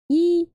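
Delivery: loud high squeal; excited squeal. Real, not acted. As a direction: squeal with delight. Squeal at cute font.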